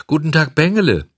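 high, quick, loud greeting; refined politeness. A narrator's reading. Fox greeting the puppet on the road, refined. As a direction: greet with polished courtesy. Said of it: "grüßte der Fuchs mit feinem Anstand".

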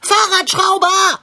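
loud high urgent call. Acted. Cat shouting for the bike mechanic, urgent and loud. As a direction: yell his name to stop him. Cat calls out to stop owner.

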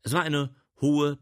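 ordinary delivery, nothing marked

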